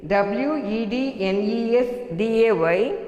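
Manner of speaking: ordinary delivery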